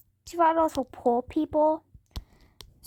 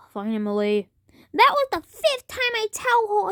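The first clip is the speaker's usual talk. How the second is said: usual speaking voice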